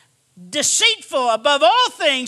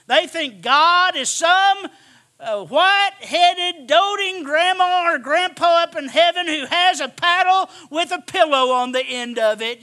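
preaching at full force; 3 semitones higher, 4 dB louder than usual. Acted. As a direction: paint the doting grandpa with scorn.

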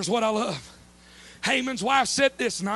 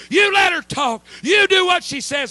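loud, pitched-up command; God's commanding shout; 7 semitones higher, 9 dB louder than usual. Acted. God overruling the king, loud and commanding. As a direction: thunder the order down like God.

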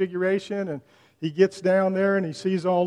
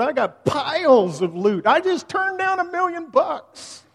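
exasperated rant; 10 semitones higher, 5 dB louder than usual. Acted. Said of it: "almost screams in exasperation".

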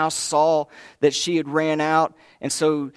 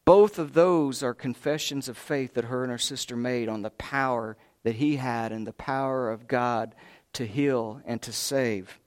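ordinary delivery

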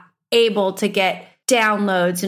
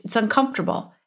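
ordinary delivery

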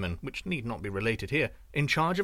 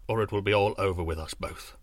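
low looming threat; 5 semitones lower than usual. A narrator's reading. Sorcerer warns.

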